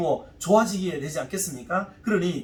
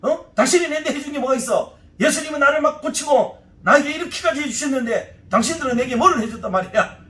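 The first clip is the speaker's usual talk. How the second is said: acted indignant challenge, louder and higher; angry retort; 6 semitones higher, 4 dB louder than usual. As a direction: lash back at them in heated, rapid bursts. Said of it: "세 번째는 막 화를 내는 방법".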